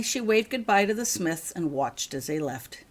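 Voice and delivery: ordinary delivery, nothing marked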